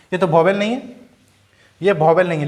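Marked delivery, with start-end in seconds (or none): none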